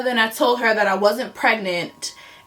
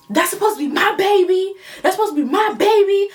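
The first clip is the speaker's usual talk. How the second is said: indignant, incredulous demand in a higher voice; very angry; 9 semitones higher, faster than usual. Retold or imitated. Man confronting her about the pregnancy, angry and high-pitched. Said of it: "very angry. And he says".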